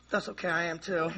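low, soft reassurance; playing the strong one. Comforting a crying friend as the strong one, softly. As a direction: drop it low and quiet, holding it together. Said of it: "I gotta be the strong one".

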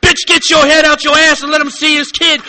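loud furious yell at her. Acted. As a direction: bark it at her like a furious father. Angry rant at child's mother.

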